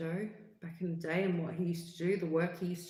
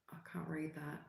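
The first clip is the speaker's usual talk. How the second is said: whispered aside; 11 dB quieter than usual. Real, not acted. Whisper to self reading.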